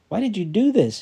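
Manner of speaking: fed-up shout at the stranger below; frustrated yell. Acted. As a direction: yell it over the edge in frustration. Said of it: "he yelled in frustration".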